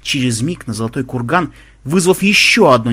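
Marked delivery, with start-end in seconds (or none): none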